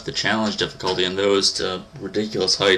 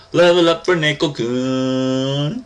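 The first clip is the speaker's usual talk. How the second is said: slow, higher, drawn-out celebration; mock triumphant announcement; 6 semitones higher, much slower than usual. Gamer announcing a level-up, drawn-out playful triumph. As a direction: stretch it out like a playful victory call.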